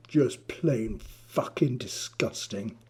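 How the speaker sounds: hissed whisper of disgust; venomous whisper. Acted. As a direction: breathe it with contempt. Bully seethes in Adrian's face.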